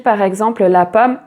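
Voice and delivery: ordinary delivery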